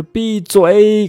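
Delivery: 闭嘴 said high and slow, leaning on the 嘴 vowel; 嘴 vowel held long and high; stress on the vowel. Shown as a demonstration. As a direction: stretch and lift the vowel of 嘴. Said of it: "在这个双元音的位置去加重".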